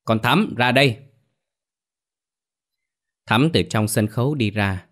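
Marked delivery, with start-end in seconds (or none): 0.0-1.0 mildly raised summoning call, higher. A narrator's reading. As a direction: call someone out from the back. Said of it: "quay vào trong kêu to".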